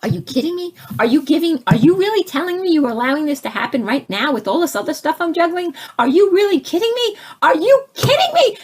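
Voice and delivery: high, fast, rising exasperated rant; ranting at God. Retold or imitated. Speaker retelling blaming God, a high exasperated rant. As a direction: blame the heavens, climbing in pitch. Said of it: "looking straight up and blaming".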